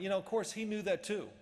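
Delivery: ordinary talk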